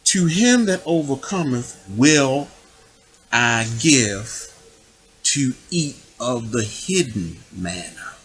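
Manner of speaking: slow, measured Bible reading; scripture reading. Acted. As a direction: read the verse out deliberately, phrase by phrase. Reads scripture aloud.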